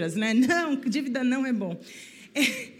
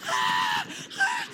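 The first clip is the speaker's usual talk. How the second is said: grito de choro. Retold or imitated. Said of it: "Eu comecei a gritar no carro".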